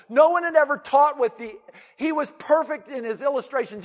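full-force preaching. Acted. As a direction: preach it out with fervor to the crowd.

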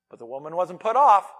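ordinary talk

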